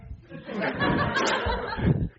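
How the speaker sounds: squeaky breathless laugh; a real high giggle at the punchline. Real, not acted. Laughing at his own story.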